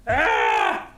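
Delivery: short low yelp; sudden startled cry. Real, not acted.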